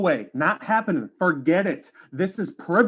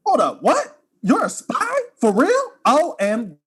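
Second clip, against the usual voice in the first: much higher and louder, disbelieving; jaw-dropped disbelief; shocked; 6 semitones higher, 4 dB louder than usual. Acted. Hearing the spy confession.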